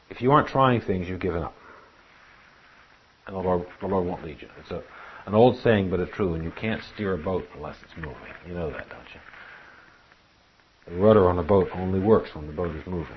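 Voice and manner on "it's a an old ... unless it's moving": ordinary talk, nothing marked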